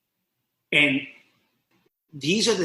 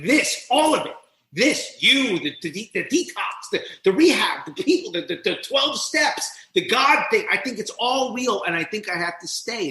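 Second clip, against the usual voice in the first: higher, rushed pleading; frantic realization; 6 semitones higher than usual. Retold or imitated. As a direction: blurt it out in a panic. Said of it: "I'm like".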